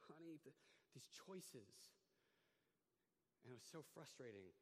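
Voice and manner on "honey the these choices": soft, slow pitying murmur; pitying sigh. Retold or imitated. Hearing her stories, he sighs in soft slow pity. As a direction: sigh it out like you're shaking your head at her. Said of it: "I just went".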